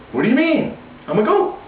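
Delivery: high, bewildered protest; confused young lion. Acted. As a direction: sound baffled, like a lion who truly thinks he's a goat. Confused lion repeats his protest.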